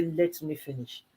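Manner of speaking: slow, soft, patient request; calm husband. Retold or imitated. Husband patiently asking wife to let him finish, slow and soft. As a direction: ask gently to be let finish. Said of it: "He'll say".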